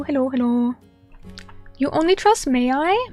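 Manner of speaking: normal talking voice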